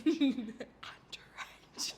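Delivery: drawn-out mock-secretive whisper; hushed aside. Joking about buying alcohol for brother, whispered aside. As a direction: whisper it slowly through giggles.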